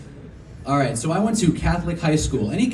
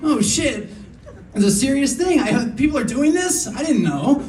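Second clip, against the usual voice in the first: shocked and high; 8 semitones higher than usual. Retold or imitated. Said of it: "I was like".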